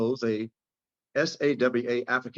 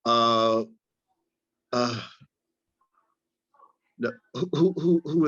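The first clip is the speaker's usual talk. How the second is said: halting confused stammer; slower than usual. Acted. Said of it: "words stay lodged in his throat".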